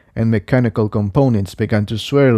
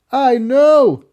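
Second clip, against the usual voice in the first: higher voice, called out louder; Jigsaw calling back; 4 dB louder than usual. Acted. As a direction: shout back over the fight. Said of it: "Jigsaw called back".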